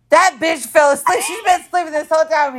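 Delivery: loud, high-pitched indignant disbelief; mock outrage. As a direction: imitate a friend's outraged discovery. Retelling friend's outraged discovery.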